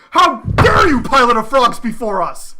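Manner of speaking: hammed-up jealous fury; mock outraged teammates. Teammates berating pilot, mock outraged yell.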